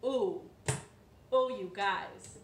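ordinary delivery, nothing marked

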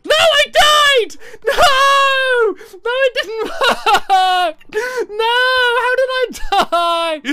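very high, loud drawn-out howl; shrieked, stretched-out protest; anguished wail. Real, not acted. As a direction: wail in comic despair at sudden failure. Dies right after the big jump.

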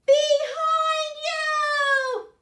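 huge high shout, slow and drawn out; yelled warning. Acted. Librarian leads kids yelling the warning, huge shout. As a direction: belt it out to warn the hen. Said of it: "can you help yell it with me".

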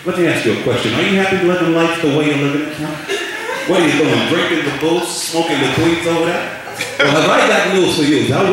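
put-on Italian-American wise-guy accent; Italian mobster preacher. Mobster preacher offers Jesus to a newcomer, Italian-American accent. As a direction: pitch salvation like an Italian mob deal. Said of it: "like to go to the Italian preacher's church".

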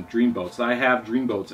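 ordinary delivery

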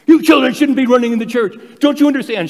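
put-on high, loud shrill-free scolding of children. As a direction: reprimand the kids as a church lady. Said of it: "she reamed them good".

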